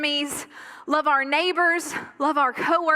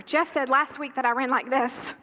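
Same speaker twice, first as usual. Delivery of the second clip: normal talking voice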